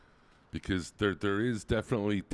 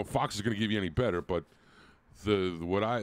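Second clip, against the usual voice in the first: usual speaking voice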